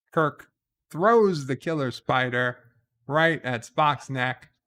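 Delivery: usual speaking voice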